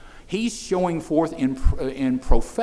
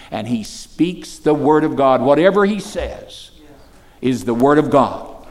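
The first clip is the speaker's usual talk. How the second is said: loud, ringing pulpit proclamation; preaching at full force; 8 dB louder than usual. Acted. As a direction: preach it out to the room. Preacher proclaims to young people.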